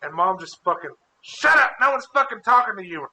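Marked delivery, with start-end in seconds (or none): 1.2-3.1 high, loud mock scolding; angry mom, imitated. Mom snapping at nosy son, retold angry yell. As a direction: cut the kid off sharply.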